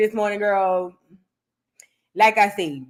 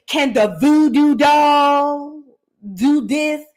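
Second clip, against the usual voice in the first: very slow, held-out "voodoo doll"; imitating her delivery; much slower than usual. Host mimics the singer saying her name, drawn-out challenge. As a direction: stretch the name out, pause, then finish. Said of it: "it's the way she be saying my name".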